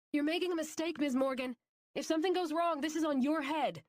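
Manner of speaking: loud, high warning call. A narrator's reading. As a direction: shout a warning down the corridor. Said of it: "called out".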